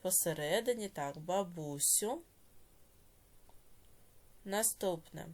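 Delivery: slow, drawn-out dictation with long pauses. Shown as a demonstration. Teacher dictating a note to pupils, slow measured dictation. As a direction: dictate word by word, stretching syllables for writers.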